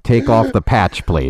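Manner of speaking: stern tired clerk; DMV clerk. Pretend. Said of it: "the person from the D M V".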